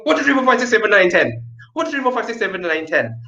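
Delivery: speedy counting drill; fast counting. Shown as a demonstration. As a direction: rush the numbers out. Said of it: "speed it up".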